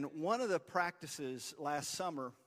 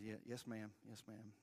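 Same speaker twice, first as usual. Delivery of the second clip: put-on meek boy; 5 semitones lower, 14 dB quieter than usual. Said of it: "He said".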